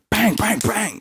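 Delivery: high, rapid-fire bang noises; imitated door bangs. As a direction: act out three knocks in a row.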